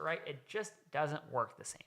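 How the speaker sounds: ordinary talk, nothing marked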